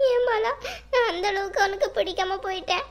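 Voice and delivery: tearful lament through sniffles; sobbing. Acted. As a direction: sob the question to an absent lover. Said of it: "உச்சு உச்சுன்னு sound வருது".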